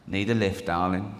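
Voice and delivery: low, soft kerb-crawler voice; creepy minicab driver. Acted. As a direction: offer it low and quiet from the car. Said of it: "and in it, he goes".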